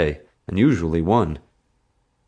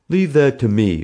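louder, higher, confident push; assertive bully; 7 semitones higher, 6 dB louder than usual. A narrator's reading. Bully brushing aside the fake-arm excuse, assertive. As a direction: take charge forcefully.

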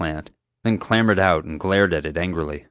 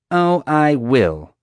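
slow, higher and louder sneer; 8 semitones higher, 6 dB louder, much slower than usual. A narrator's reading. Guard taking up the boy's offer to search, sneering. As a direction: draw it out with relish. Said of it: "Marge sneered".

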